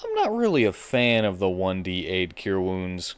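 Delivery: ordinary delivery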